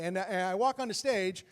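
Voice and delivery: ordinary talk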